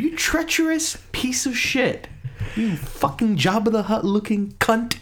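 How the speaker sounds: high-pitched, drawn-out mock indignation; mock betrayed outrage. Host insulting the co-host he expected it from, in mock outrage. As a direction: slowly savor each insult in a high, strained voice.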